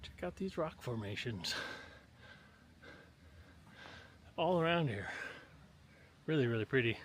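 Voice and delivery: panting between words while hiking uphill; breathless from the climb; out of breath. Real, not acted. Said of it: "Sorry if I'm panting".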